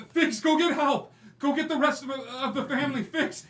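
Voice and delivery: high, loud terrified calls for help; desperate screaming. Acted. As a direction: scream for your brother from the locked room. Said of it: "he just starts screaming out".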